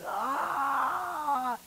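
a high, short cry of the damned; brief high wail, like someone crying out in torment; mock anguished cry. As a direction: let out one sudden high wail, imitating a tormented soul. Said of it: "wicked people screaming out, crying".

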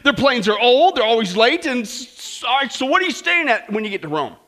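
loud, disdainful put-down of the airline; mock-snooty hairdresser sneering; scornful hairdresser. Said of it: "hairdresser says".

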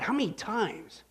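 usual speaking voice

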